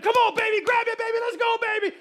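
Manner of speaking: loud, high parent cheering from the sidelines; reenacted wild, excited urging; freaking out. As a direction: yell encouragement frantically. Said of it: "And I started freaking out".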